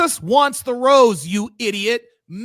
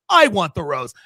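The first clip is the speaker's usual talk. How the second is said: much lower, sly confession; host unmasking the pastor's motive; mock low translation; 7 semitones lower than usual. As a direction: drop the voice to reveal the real motive. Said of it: "That's a proxy for".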